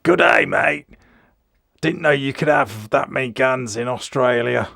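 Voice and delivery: light imitation of an Australian commenter; a playful, slightly exaggerated Australian accent; mock Australian accent. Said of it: "seem to think I'm Australian".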